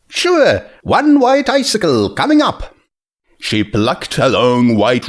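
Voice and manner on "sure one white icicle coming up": much higher, bright friendly seller; a cheerful market mare greeting a regular; friendly vendor, much higher; Junebug voice. A narrator's reading. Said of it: "Junebug voiced cheerfully".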